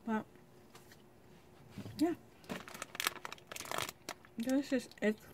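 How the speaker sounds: finishing a bite; talking while chewing. Real, not acted. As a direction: mouth partly full. Seen 4: Bites chocolate marshmallow at -2; closed-lip chewing motions through 5.5.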